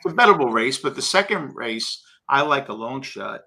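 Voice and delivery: ordinary talk, nothing marked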